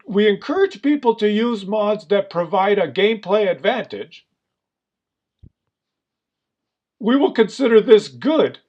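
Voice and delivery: high, emphatic, incredulous read-out; exasperated mocking recital. Real, not acted. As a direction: hammer out the policy as if it's absurd. Mock-paraphrasing policy in exasperation.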